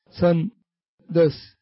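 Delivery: slow "سندس" with the ن clearly sounded, split before دس; إظهار النون. Shown as a demonstration. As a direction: stop the tongue on ن in "سندس", then finish. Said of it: "يعني يظهر ال-، توقف اللسان مع الحنك". (نطق "سندس" ببطء مع إظهار النون الساكنة)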